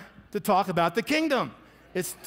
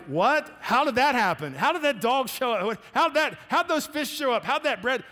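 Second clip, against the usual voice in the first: higher, amazed string of questions; astonished; 5 semitones higher than usual. Retold or imitated. Believer reacting to a first miracle, astonished. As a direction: marvel at each impossible thing. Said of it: "once you experience the first".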